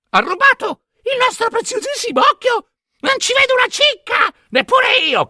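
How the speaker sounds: very high screechy crone voice; wailing hags. A narrator's reading. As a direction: squeal in outrage over the stolen eye. Blinded hags wail over stolen eye.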